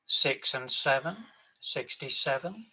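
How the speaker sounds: loud, drawn-out bingo calls; bingo caller. Acted. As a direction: call the numbers across the hall. Bingo caller calling numbers.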